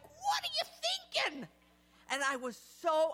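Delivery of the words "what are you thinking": mock disbelief. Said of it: "they're looking at me like".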